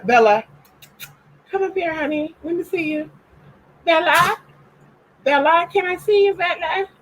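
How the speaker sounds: very high sweet call to the dog; high pet-talk coaxing. Real, not acted. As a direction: coax the dog out gently and high.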